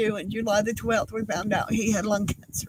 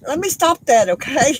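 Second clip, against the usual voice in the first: higher and louder, brisk and firm; pulling herself together; 5 semitones higher, 7 dB louder than usual. Real, not acted. Widow telling herself to stop crying, brisk and louder. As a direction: snap out of it with a forced lift.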